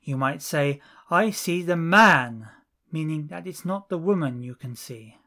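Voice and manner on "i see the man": "man" said louder with a falling tune; stress on man. Shown as a demonstration. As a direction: punch the word "man" to set it apart. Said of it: "we normally raise our voice".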